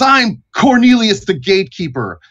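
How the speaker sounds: formal welcome easing into stern warning; Cornelius the Gatekeeper. Acted. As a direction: announce yourself, then lay down the law. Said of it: "And he says".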